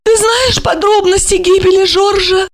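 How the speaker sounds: high female voice; sorrowful question. A narrator's reading. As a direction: ask it with sad urgency. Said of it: "печалясь".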